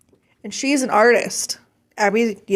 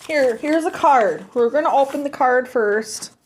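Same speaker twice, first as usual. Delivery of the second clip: ordinary talk, nothing marked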